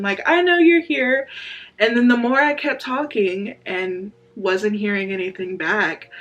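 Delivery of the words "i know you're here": raised, louder confident retelling; casual confidence. Retold or imitated. Woman retelling her late-night prayer to God, confident. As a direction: insist confidently, pitch lifted. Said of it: "just casual and confident".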